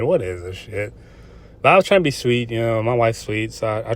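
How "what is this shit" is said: inner scoff. Retold or imitated. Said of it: "I'm thinking in my head".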